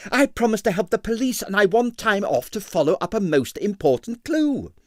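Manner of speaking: put-on high, earnest young man's voice; eager young man. Stanley begging the boss for the evening off, high and earnest. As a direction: plead your case eagerly and high.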